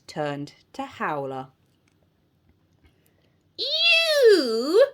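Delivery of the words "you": high, loud witchy snarl. A narrator's reading. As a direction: snarl it accusingly as a witch teacher. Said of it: "snarled Missus Spell".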